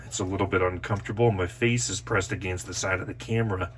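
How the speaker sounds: breathing hard while talking; heavy breathing. Real, not acted. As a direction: puff through the words, face pressed in. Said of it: "Sorry for the heavy breathing".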